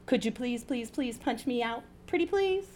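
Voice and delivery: small, soft, high begging voice; wheedling plea. A narrator's reading. Lee begging Paula to punch her out, wheedling.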